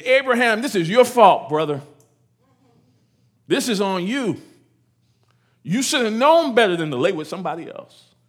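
high, loud, furious blaming shout; a wife yelling at Abraham that it's all his fault; angry wife's outburst. Retold or imitated. Wife yelling at her husband Abraham, loud and furious. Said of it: "That's how she said it".

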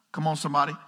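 usual speaking voice

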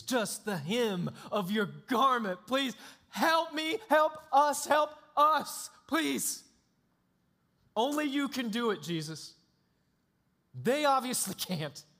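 high, loud begging, voice straining; desperate pleading. Acted. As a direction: plead for your son's life. Father begging Jesus for help.

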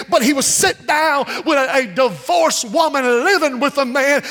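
ordinary talk, nothing marked

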